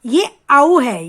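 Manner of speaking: slow, loud teacher model of 'औ'; wide औ sound. Shown as a demonstration. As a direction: draw out 'औ' slowly, mouth wide. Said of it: "मुंह फैलाकर बोला जाता है".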